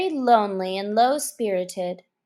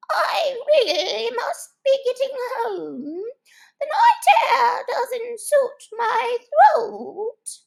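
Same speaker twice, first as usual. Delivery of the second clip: much higher, slow fussy excuse; fussy old magpie; 5 dB louder, slower than usual. Acted. Old magpie excusing itself to leave, high and fussy. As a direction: make a flustered excuse to leave.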